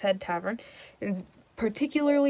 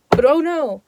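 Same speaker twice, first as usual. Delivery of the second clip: much louder and higher sudden "oh no"; dramatic alarm; 7 semitones higher, 10 dB louder than usual. A narrator's reading. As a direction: announce the twist with mock alarm. Dramatic plot turn.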